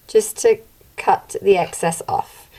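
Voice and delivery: ordinary delivery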